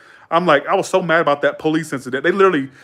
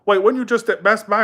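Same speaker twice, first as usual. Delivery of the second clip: higher-pitched mimicked disbelief; puzzled customer; 7 semitones higher than usual. Customer walking in on him at work, puzzled. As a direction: quote a confused customer, voice pitched up. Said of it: "Or they'd be like".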